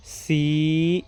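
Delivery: each word stretched long, with a pause after; drawn-out teacher's model reading. Shown as a demonstration. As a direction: read it out slowly for beginners. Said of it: "इसको हम एक साथ अब पढ़".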